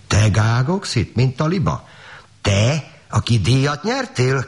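farmwife's high-pitched outrage, mildly shouted; indignant exclamation. A narrator's reading. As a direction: draw out each accusation in disbelief. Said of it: "kiáltotta a gazdasszony".

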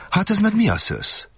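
little prince puzzled by the airplane; curious little prince. A narrator's reading. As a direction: ask with wide-eyed curiosity. Prince sees the plane.

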